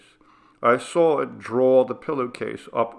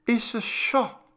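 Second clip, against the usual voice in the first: mother's voice; 13 semitones higher than usual. Acted. Mother explains to father.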